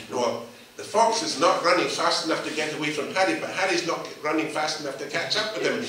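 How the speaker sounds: usual speaking voice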